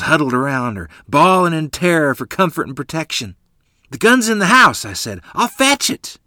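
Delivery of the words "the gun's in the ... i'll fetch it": urgent offer. Acted. Boy rushing for the gun.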